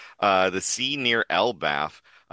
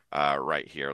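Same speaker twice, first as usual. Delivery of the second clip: usual speaking voice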